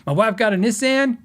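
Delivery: "Nissan" said NISS-an, imitating him; "Nissan" with short i and a as in can; Canadian friend's pronunciation. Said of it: "he would be like".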